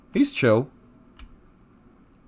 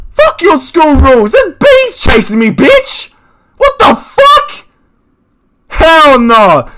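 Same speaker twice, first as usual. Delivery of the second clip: defiant yell; 11 semitones higher, 8 dB louder, slower than usual. Retold or imitated. Retorts to teacher while fleeing bees.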